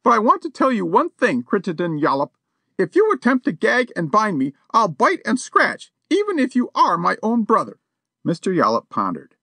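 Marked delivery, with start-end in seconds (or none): 0.0-7.8 high-pitched outraged society lady; a lady defying a burglar, high and indignant. A narrator's reading. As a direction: threaten him from injured dignity. Missus Champney voiced.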